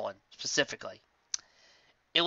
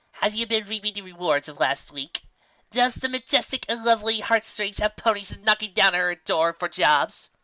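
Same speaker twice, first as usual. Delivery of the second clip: higher, grand and teasing; showy Trixie voice; 8 semitones higher, 4 dB louder than usual. Acted. Trixie teasing Lyra about her fame, grandly. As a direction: play the boastful showmare.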